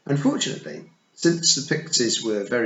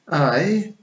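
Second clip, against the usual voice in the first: quavering; 8 semitones higher, 8 dB louder than usual. A narrator's reading. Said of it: "Rob Anybody quavered".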